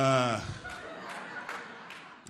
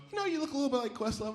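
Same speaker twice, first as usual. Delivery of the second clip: high, quick offhand remark; light, speedy observation in a high voice; casual acquaintance; 10 semitones higher, faster than usual. Acted. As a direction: toss it off quickly, pitched up.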